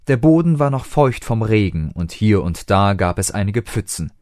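ordinary delivery

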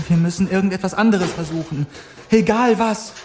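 put-on high, pleading young woman's voice; desperate Clara. Clara urges the doctor to try anything, high and desperate.

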